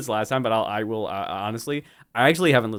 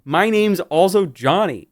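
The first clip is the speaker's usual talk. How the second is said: slow joke; 5 semitones higher, 5 dB louder, much slower than usual. Pretend. Joking claim, drawn out.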